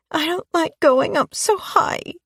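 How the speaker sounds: put-on high, small child's voice, tearful; little girl Chloe. A narrator's reading. Chloe telling Rose she dislikes swinging high, childlike. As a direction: voice a young girl near tears. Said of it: "said Chloe".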